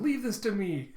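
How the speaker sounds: high, cute cartoon voice; acted high-pitched adorable eagerness; Chopper, adorable apprentice. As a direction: pipe up like an eager little helper. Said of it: "Chopper just adorably by his side".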